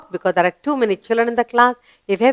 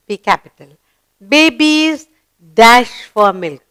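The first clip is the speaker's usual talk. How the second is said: normal talking voice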